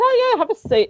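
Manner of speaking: high, bright mock welcome from Val; cheery Val greeting. Val welcoming her visitor to sit, in a high cheery mock voice. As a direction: sing out a friendly have a seat.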